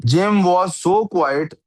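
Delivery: slow, word-by-word reading aloud; slow test-sentence reading. Shown as a demonstration. Teacher reading a test sentence to the student, slow and deliberate. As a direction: read each word out slowly, testing it.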